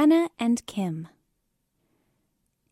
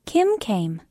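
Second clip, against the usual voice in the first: plain reading voice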